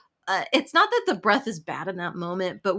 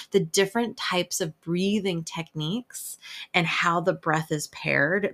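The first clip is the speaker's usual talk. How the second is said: usual speaking voice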